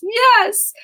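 high, loud burst of triumph; excited cheer; excited yes. Real, not acted. Coach cheering a breakthrough.

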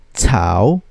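"cao" long, dipping low then rising; third tone. Shown as a demonstration. Teacher drilling learners in third tone, "cao" dipping and rising. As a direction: sink down on "cao" and climb back up. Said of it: "One more time".

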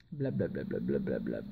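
very low, soft, drawn-out babble; low placeholder murmur; mumbled filler. Real, not acted. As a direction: mumble it slowly under your breath. Filler while searching for the title.

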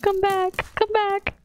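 high, repeated pleading; high pleading calls. Real, not acted. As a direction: beg her to come back, voice up high. Begging teammate to return.

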